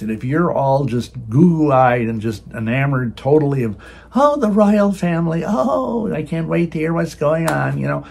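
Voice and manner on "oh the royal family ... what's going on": higher, gushing mock fan voice; mock royal fan. Preacher mocking Americans enamored of the royal family, higher gushing voice. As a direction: gush like a starstruck royal watcher.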